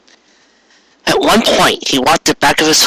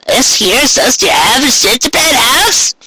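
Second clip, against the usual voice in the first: put-on very high, creaky old pony drawl; a chirpy country grandma; old Granny Smith voice; 12 semitones higher than usual.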